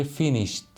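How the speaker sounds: فینیشت with a crisp "t" ending; teacher's فینیشت, ending in t, no extra vowel; final t sound. Shown as a demonstration. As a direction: release a separate t at the end of فینیشت.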